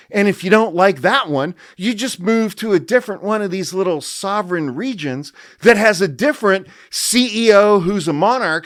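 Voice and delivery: loud, high smug lecturing voice; hammed-up know-it-all monologuing at you; pompous Yarvin rant. Yarvin voice continues mocking.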